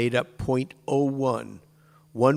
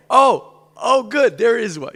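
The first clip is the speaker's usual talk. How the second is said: high, loud, delighted cry of finding one; a hammed-up happy find; mock excited discovery; 10 semitones higher, 9 dB louder than usual. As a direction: act out spotting it with glee. Acting out spotting a kippah.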